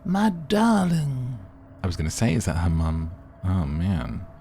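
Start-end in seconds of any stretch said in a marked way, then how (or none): 0.0-1.4 put-on high, drawn-out older Russian lady; older Russian mother. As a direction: slowly croon it as a Russian mama. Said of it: "An older Russian woman".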